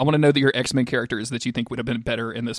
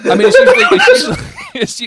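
laughing; 11 dB louder, faster than usual. Real, not acted. Cracking up over [name] cowboy.

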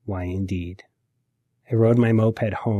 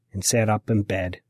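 usual speaking voice

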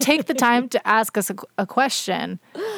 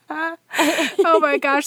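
laughing; 7 semitones higher, much slower than usual. Real, not acted. Laughing slowly, high.

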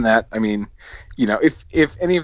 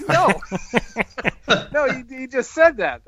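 laughing protest; 13 semitones higher than usual. Retold or imitated. Retelling exchange with Kevin.

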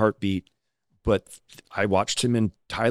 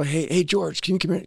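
lightly acted higher-pitched call to George; calling someone over; 8 semitones higher than usual. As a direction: get George's attention, pitched up. Calling George over in retold story.

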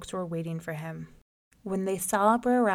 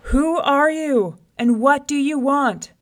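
loud, slow, higher holler; lion hollering; 7 semitones higher, 8 dB louder than usual. Acted. Lion hollering at the rabbit on his doorstep. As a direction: bellow it through the open door. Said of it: "hollered out loud".

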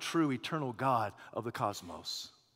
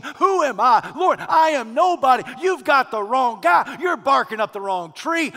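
building, louder sermon climax; preaching at full force; 5 semitones higher, 10 dB louder than usual. Acted. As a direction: preach it harder line by line.

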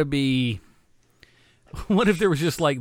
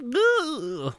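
ghost moan; 11 semitones higher than usual. Pretend. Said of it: "People weren't scared of my movie".

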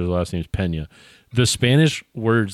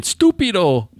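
mock Spanish accent; 8 semitones higher, 4 dB louder than usual.

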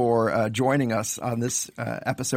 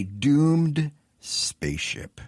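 horror trailer voice; 2 semitones higher, much slower than usual. Shown as a demonstration. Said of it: "I want to say that again".

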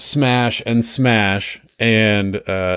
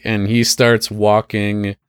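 normal talking voice

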